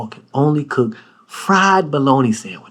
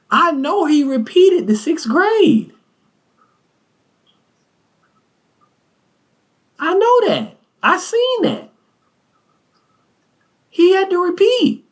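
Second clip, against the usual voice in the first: mock high teasing. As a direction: squeal the tease way up high. Teasing the friend's reading.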